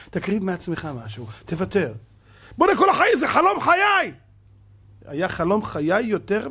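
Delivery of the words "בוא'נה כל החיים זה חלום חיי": loud, high-pitched whining plea; mock whine of someone who must have it; whiny wanting. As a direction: beg like a spoiled child. Mimicking the same person insisting.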